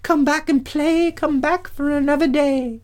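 very high, pleading nymph voice; high nymph calling after a departing friend. Acted. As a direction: beg him to return in a high-pitched little voice. Nymphs plead with Perseus to return.

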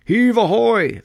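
high, loud sailors' call; sailors' shout. Acted. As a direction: haul the chests up with a hearty cry. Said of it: "they shouted".

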